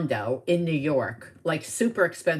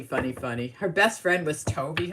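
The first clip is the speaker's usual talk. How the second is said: ordinary talk, nothing marked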